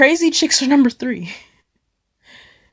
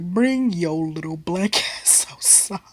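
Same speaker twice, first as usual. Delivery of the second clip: slow, deliberate mom command; stern mom; 3 semitones lower, 5 dB quieter than usual. Retold or imitated. Mom ordering her daughter outside, slow and stern. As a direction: spell it out like a mother who's done.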